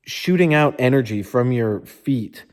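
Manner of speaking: ordinary delivery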